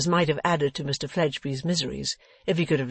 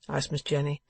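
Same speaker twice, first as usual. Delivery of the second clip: ordinary delivery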